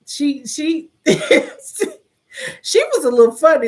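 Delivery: loud, high, laughing through the words; slow words broken up by laughter; real laughing. Real, not acted. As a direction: laugh fondly while remembering. Laughs recalling mother-in-law's funny sayings.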